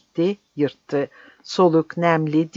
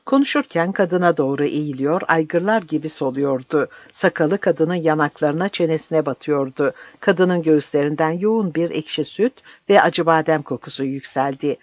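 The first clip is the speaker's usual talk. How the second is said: usual speaking voice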